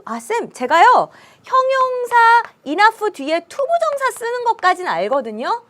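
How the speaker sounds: high, eager student asking the teacher. Pretend. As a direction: play a curious pupil.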